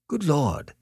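high, blank astonishment; blankly. A narrator's reading. Beverly watches Annette burst into tears, blankly taken aback. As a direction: react blankly to the outburst. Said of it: "said Beverly blankly".

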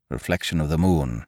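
usual speaking voice

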